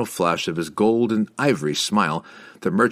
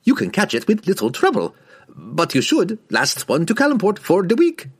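higher, lively market trader; merchant voice; 11 semitones higher, 4 dB louder than usual. A narrator's reading. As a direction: hawk it like a desert merchant. Merchant's voice in the story.

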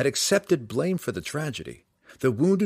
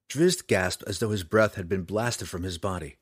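ordinary delivery, nothing marked

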